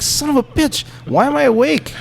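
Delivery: high, loud yelp of pain; retold cry of pain from the operating table; pained outburst. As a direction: cry out as if waking mid-stitch. Waking mid-surgery in pain.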